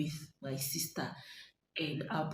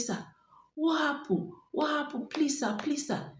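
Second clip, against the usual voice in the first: much higher, anxious retold begging; frightened pleading; 10 semitones higher than usual. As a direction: plead like a scared passenger. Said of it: "please, sir" that I was saying".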